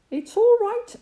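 calling. Acted. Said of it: "he called".

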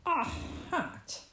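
understated fox sneer. A narrator's reading. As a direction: sneer mildly at an unwanted gift. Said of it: "sneered Fox".

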